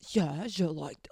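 mock put-on sexy voice; a hint of his husky act; imitating his voice. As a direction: copy his odd accent. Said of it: "make this voice".

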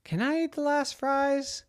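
高声扮演小男孩发问; little brother voice. A narrator's reading. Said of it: "her brother said".

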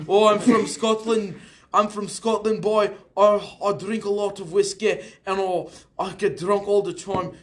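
rough attempt at a Scottish accent. Scot telling of his whiskey drinking, Scottish accent. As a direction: play a boastful drinker in mock Scottish. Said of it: "we're gonna cross the Atlantic Sea to Scotland".

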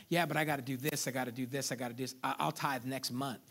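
low, dwindling string of excuses; excuse-making. Acted. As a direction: trail off softly while putting it off. Acting out excuses for not tithing.

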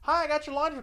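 high, loud sing-out of a mom barging in; cheery mom. Retold or imitated. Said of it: "standing mom".

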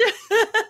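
squealing high laugh. Real, not acted. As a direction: let out a high giggle. Host laughs again.

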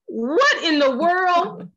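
high, slow disbelief; what in the world, stretched out; incredulous. Real, not acted. Reacting to negative apology habit.

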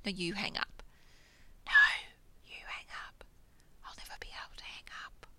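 soft whispered lovestruck phone goodbye; giggly late night call. Acted. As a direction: whisper like you can't hang up.

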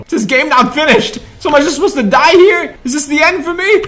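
high, loud fearful pleading; panicked whine. Real, not acted. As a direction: beg in real panic. Trapped by Huggy Wuggy.

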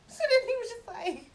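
high, loud laugh through the words. Real, not acted. Telling friends about his reaction, laughing. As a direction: crack up while setting up the quote.

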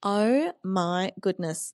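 slowly drawn out oh my goodness. Real, not acted. Friend builds up her story, slow and drawn out. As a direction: stretch each word out slowly. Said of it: "I'm so glad you said that so slowly".

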